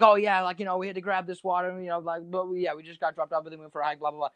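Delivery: imitating a fast, rambling excuse; speed talking. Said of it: "Just speed talking".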